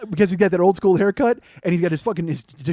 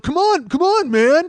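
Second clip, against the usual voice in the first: high, raised exasperated plea; pissed-off protest; 8 semitones higher, 5 dB louder, slower than usual. Retold or imitated. Rocky to the man who shook him, high pissed-off protest. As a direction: imitate a dizzy man protesting loudly. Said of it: "he's all pissed off".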